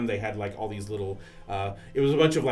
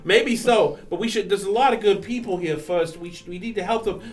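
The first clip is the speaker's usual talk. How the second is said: lightly voiced young man; 10 semitones higher, 8 dB louder than usual. Plays Snark character.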